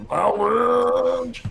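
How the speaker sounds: high, loud booming shout; booming game character voice. Retold or imitated. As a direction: bellow it like a hammy game hero. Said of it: "Was that just a strong man impression".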